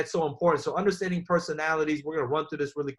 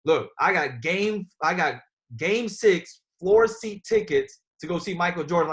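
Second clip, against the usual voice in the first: slow, louder, building excitement; hyped invite; 4 dB louder, slower than usual. Shown as a demonstration. Team leader demos the right invite, louder and hyped. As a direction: sell it like floor seats at game six. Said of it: "That's how you gotta invite".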